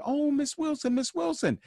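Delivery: put-on high child voice calling the teacher; lightly voiced happy schoolkids; excited kids. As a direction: squeal her name like a delighted kid.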